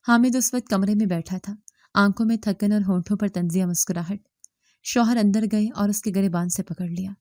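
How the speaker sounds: plain reading voice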